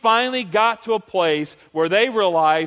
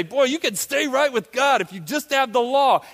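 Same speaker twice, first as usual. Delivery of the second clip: mock confident; 7 semitones higher, 3 dB louder than usual. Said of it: "You say".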